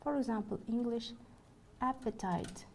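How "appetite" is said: "appetite" stressed on its first syllable, AP. Shown as a demonstration. Lecturer gives an English example of free stress, first-syllable stress in "appetite". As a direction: hit the opening AP of "appetite". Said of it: "fall on the first syllable".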